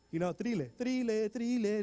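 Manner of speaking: Thriller said as "tree-leh", Spanish vowels; Spanish-accented Thriller. Shown as a demonstration. Kid mangling Thriller in kindergarten, "tree-leh". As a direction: say Thriller like a kid who only knows Spanish. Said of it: "I didn't even say it right".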